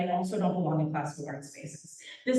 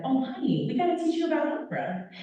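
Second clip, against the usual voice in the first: fondly encouraging woman, pitched up; higher-pitched, kindly "oh, honey" delivery; not condescending; 7 semitones higher than usual. Retold or imitated. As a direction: imitate a welcoming mentor, pitch lifted. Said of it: "like, not condescending".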